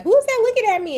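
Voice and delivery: high, loud startled squeal. Retold or imitated. Catching herself on camera, high startled yelp. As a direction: jump at your own face on camera. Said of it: "then you look up like".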